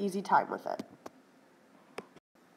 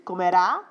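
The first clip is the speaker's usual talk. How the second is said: Comerá with the final -rá hit louder; stress on the final a; 5 dB louder than usual. Shown as a demonstration. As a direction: land the accent on -rá of Comerá. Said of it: "It's gotta be where that accent falls".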